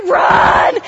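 frantic whispered-shout to run; breathy shouted run. Retold or imitated. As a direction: blurt the order to flee in a rush. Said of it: "it's like".

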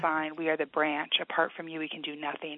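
soft, earnest prayer voice, quiet and steady; praying over a hurting caller; heartfelt prayer. Real, not acted. Author prays for struggling caller, softly earnest.

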